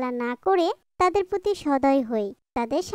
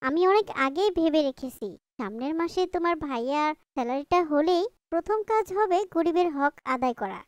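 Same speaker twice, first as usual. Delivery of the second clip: ordinary talk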